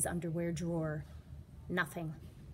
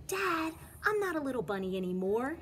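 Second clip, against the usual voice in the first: put-on lightly raised kid voice, fast; proud child; 7 semitones higher, 3 dB louder, faster than usual. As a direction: insist to Dad he's grown up. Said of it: "said Jasper".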